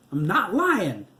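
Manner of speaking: high, loud scream. A narrator's reading. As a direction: scream the denial at full force. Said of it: "Nancy screamed".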